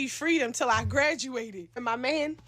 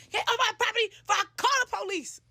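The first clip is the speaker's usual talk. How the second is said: high-pitched mock yell; furious father; 9 semitones higher than usual. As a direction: imitate an enraged dad ordering someone off. Retells furious father's threat.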